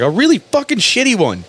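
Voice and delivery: high-pitched, indignant jab; testy comeback. Acted. Driver answering the cop about a beerless comedy show, testy comeback. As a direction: fire back with pitch soaring. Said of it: "I get testy with cops".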